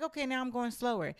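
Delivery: defiant retort. Retold or imitated. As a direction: toss it off, pointedly defiant. Defiant reply to extra work.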